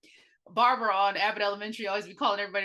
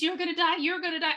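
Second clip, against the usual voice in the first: higher, sharper jab at Rose; pointed warning; 8 semitones higher than usual. Retold or imitated. Patient aiming it at Rose, higher and pointed. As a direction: aim it right at her face. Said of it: "So it was pointed at Rose".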